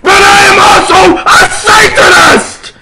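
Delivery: high, loud hammed-up crying shout; mock furious wail; angry declaration. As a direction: scream the revelation. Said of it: "Hagrid paused angrily".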